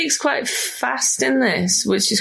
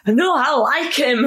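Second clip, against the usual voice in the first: higher, drawn-out mock glee; gleeful; 5 semitones higher than usual. Acting out Connery meeting Andy Garcia, gleeful. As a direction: relish it slowly, pitched up. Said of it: "it's the gleeful expression on his face".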